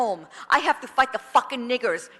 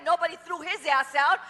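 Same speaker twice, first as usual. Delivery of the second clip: usual speaking voice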